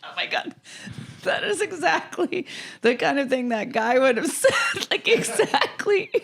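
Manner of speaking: high, breathless laughing speech; laughing hard. Real, not acted. Guest cracking up at host's impression, laughing. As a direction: struggle to talk through real laughter.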